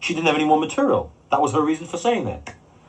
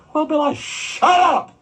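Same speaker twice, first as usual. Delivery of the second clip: high, slow, tiny peeping voice; timid peep; much slower than usual. Retold or imitated. Scared co-star peeping out to the raging promoter, tiny high voice. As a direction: squeak it out like a scared young man. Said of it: "he peeps out".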